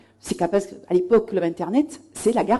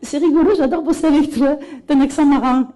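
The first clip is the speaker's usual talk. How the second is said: higher, louder, lightly mimicked delight; imitated colleague; 4 semitones higher, 4 dB louder than usual. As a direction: mimic a tickled colleague, pitched up. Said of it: "Et elle dit".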